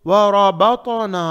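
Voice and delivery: वरबतना slow, with full rounded ta; heavy ta. Shown as a demonstration. Teacher models the word, heavy ta. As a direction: stretch वरबतना and thicken the ta. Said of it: "ता को मोटा पढ़ेंगे".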